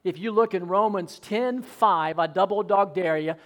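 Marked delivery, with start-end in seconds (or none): none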